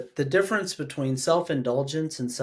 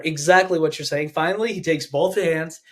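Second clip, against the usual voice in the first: usual speaking voice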